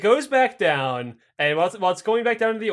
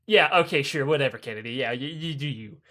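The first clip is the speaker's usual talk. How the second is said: dismissive; 5 semitones lower, 4 dB quieter than usual. Retold or imitated. Russians dismissing Kennedy.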